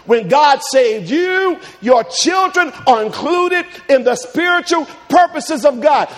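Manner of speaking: rising sermon cadence, loud and drawn out; preaching. Acted. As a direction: preach it, stretch every word. Preaching at full force.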